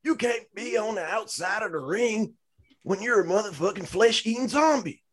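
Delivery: high-pitched, hammed-up furious rant in a put-on voice; Braun Strowman. Podcaster lecturing the idiots about zombies, high mock-irate rant. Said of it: "Why is Braun Strowman, uh, complaining about the zombies?".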